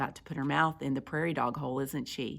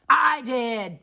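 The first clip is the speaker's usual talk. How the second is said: loud, raised bark; barked confession; 7 semitones higher, 8 dB louder than usual. Acted. Big Bark owning up from above, barked. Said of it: "barked a voice from above".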